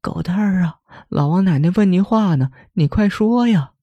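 high, tearful pleading to her son; crying mother. A narrator's reading. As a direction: beg through tears, voice pitched high. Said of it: "哭着说". (带着哭腔的尖声哀求)

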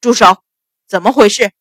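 loud, authoritative halt; forceful officer's bark; shouted command. A narrator's reading. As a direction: break up the brawl with a shout. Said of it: "只听门外喝道".

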